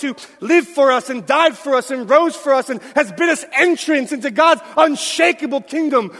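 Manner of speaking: fast, ringing pulpit climax, thanking Jesus; preaching at full force. Acted. Pastor rousing the congregation to celebratory gratitude, shouting praise.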